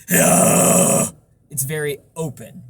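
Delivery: slow, loud airy distorted push; breath distortion. Shown as a demonstration. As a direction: push it out on a rush of air. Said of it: "when I do the breath distortion".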